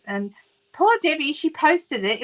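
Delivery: ordinary talk, nothing marked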